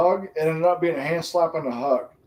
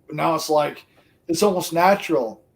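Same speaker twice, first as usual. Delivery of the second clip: ordinary talk, nothing marked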